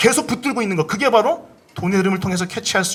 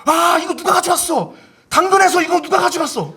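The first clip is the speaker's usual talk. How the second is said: mock anger; 8 semitones higher than usual. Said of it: "분노가 아니라".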